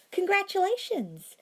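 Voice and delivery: high, loud gushing congratulations; thrilled for the couple; excited. A narrator's reading. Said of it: "said with more excitement".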